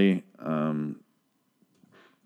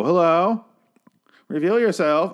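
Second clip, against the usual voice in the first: mock horror victim; 9 semitones higher than usual. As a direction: parody the foolish victim. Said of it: "You don't go".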